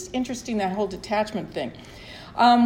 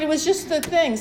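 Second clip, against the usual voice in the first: ordinary talk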